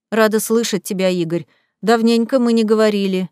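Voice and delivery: ordinary delivery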